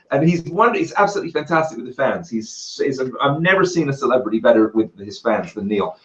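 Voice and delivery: usual speaking voice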